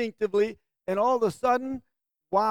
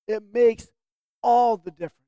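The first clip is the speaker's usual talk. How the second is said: "all" punched louder, words pressed home; emphasis on all; 6 dB louder than usual. Shown as a demonstration. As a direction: lean hard on "all the difference" to drive the point. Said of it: "I'm even using it now for emphasis".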